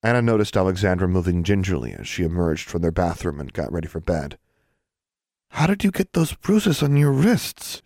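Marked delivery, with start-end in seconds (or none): 5.5-7.8 put-on slow, higher girl's voice; slow high concerned friend; Anna's worried voice. A narrator's reading. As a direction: ask gently, concerned. Anna worried.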